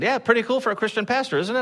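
bigger proud quip. Retold or imitated. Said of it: "I said".

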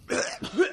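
breathy imitated cough; mock cough. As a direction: fake a smoker's cough. Imitating coughing after puff.

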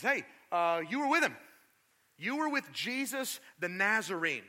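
high, loud accusing call; accusing servant girl. Acted. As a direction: point him out loudly.

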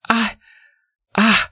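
high, halting stammer; acted tongue-tied girl; struggling to speak. A narrator's reading. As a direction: get out one word and stall. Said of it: "she tried to utter an apology".

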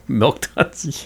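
a high burst of laughter into Milk Duds?; laughing disbelief. Acted. Chocolate snob laughing at the host's favourite candy. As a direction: laugh it off as absurd. Scoffing at his candy choice.

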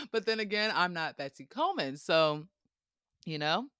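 ordinary talk

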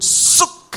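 sharp, loud hissed whoosh; sudden voiceless zip noise; whoosh sound effect. Retold or imitated. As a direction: imitate a stone zipping through the air. Imitates a stone flying.

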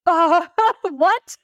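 high, laughing "what?" in disbelief; a startled laugh at an outrageous ask. Retold or imitated. Retelling her reaction to God's request, laughing in disbelief. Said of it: "And I'm just like".

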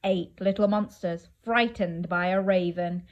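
plain reading voice

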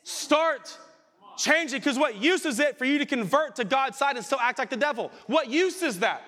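preaching at full force. Acted. Preacher rails at the congregation about empty conversion, fiery shout. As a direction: hammer the point home to the back row.

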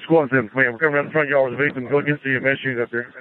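fast, breathless radio call on scene; urgent firefighter radio. Real, not acted. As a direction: rush the call over a crackling radio. Rescuer radios in carrying victim.

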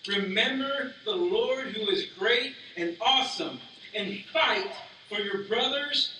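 slow, rising rallying sermon voice; preaching. Acted. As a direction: build it up like a rallying cry. Reads Nehemiah's rallying speech.

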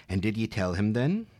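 usual speaking voice

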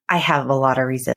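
ordinary talk

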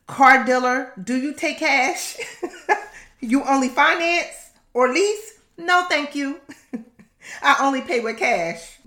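high laughing mock negotiation; laughing refusal. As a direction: tease the car dealer while laughing. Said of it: "Yeah, I said that".

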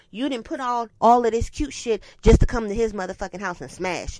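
ordinary delivery

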